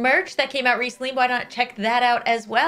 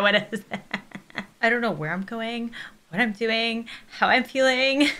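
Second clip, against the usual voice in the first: usual speaking voice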